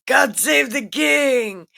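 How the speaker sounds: high, loud shout of the acclamation; crowd cheer. Retold or imitated. Crowd hails the new king, loud shout.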